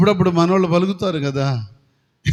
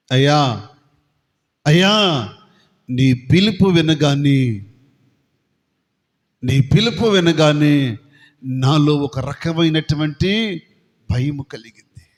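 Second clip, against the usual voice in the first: fearful confession; 6 semitones lower, slower than usual. Acted. Adam answering God fearfully.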